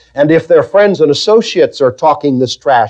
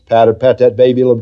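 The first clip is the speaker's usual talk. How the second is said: soothing mother; 10 semitones lower than usual. Retold or imitated. Mother hushing her crying baby, low soothing coo. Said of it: "all she does is".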